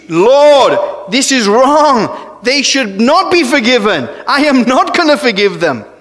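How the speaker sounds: acted scream of protest, drawn out at first. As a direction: cry out in outraged refusal.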